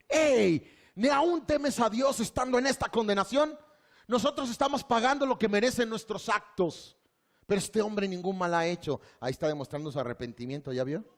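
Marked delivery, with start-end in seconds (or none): 0.1-9.0 stern, raised, higher rebuke; rebuking thief. Retold or imitated. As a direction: scold the other thief with conviction. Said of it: "mire lo que hace". (el ladrón arrepentido, reprendiendo)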